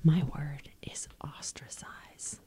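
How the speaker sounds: slow secret whisper. Real, not acted. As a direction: hush it right in your ear. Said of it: "I hope that they could hear my whisper".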